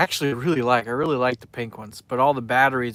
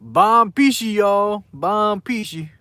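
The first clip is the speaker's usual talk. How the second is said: "Bon Pishi" in Creole, belted out higher and louder; proud Creole greeting; 7 semitones higher, 7 dB louder, slower than usual. Shown as a demonstration. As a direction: call out the Creole "Bon Pishi" with gusto, loud and high. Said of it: "That's, uh, that's how you say it".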